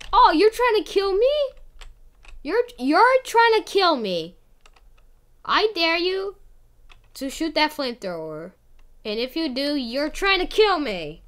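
high, loud outraged accusation; indignant. Real, not acted. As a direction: call out the betrayal. Accuses friend of attacking.

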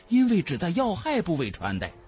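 usual speaking voice

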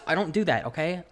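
normal talking voice

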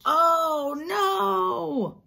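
high, drawn-out cry of dismay; dismayed exclamation. Acted. Teacher reacts to the broom in trouble, big dismayed cry. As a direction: stretch it out in storybook alarm.

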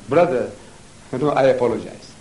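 usual speaking voice

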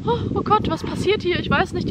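high, startled mock gasp; imitated alarmed midwife. Retold young midwife's startled reaction.

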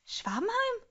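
Schwabenheim? squeaked up very high and soft; high incredulous squeak. Real, not acted. Repeats the name, squeaking high.

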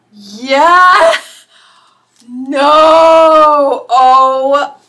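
loud, long-held cries of disbelief; thrilled disbelief. Real, not acted. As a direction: belt it out, stunned and thrilled. Stunned by favourite-show gift.

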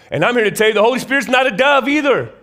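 loud, high declaration; emphatic preaching. Real, not acted. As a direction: proclaim it loudly and high. Preacher correcting a belief.

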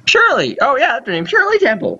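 high, put-on old-lady voice; forgetful old mother. Mother remembering the girlfriend's name, high old-lady voice. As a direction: recall it in a high old-woman voice.

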